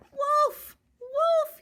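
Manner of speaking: very high loud shout; crying fire. Acted. Jack tries to scare the birds off, high shout. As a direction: yell it out in alarm. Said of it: "he cried".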